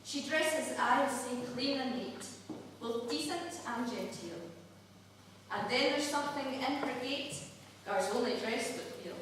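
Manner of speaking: soft, measured Scots verse reading; poem recital. Acted. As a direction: recite it tenderly, line by line. Recites a Burns love poem.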